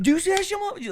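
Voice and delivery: a clerk hollering about the guy outside; excited clerk shout. Retold or imitated. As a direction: call it out like a worked-up store clerk. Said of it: "He's like".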